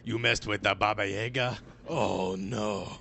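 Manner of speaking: lightly voiced film villain; movie crime boss. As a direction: play a touch of movie villain. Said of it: "He looks like a boss in, like, a John Wick movie".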